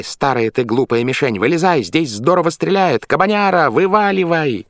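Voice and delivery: high, loud call across a yard. Acted. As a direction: shout it out to the boar. Calling the boar out of well.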